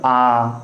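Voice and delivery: आ stretched out long; long आ, held; drawn-out vowel. Shown as a demonstration. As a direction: pull the आ out slowly. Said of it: "देख रहा हूँ मैं खींच कैसे रहा हूँ".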